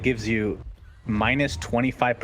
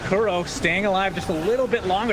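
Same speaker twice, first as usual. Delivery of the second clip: higher-pitched esports fight call; live play-by-play; 7 semitones higher than usual. Real, not acted. Caster telling viewers who survives the fight, higher-pitched play-by-play. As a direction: call the team fight as it unfolds.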